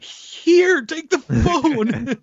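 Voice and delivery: very high-pitched flustered impression; flabbergasted mom. Imitating the mom told to call the cops, flabbergasted. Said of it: "she's so flabbergasted".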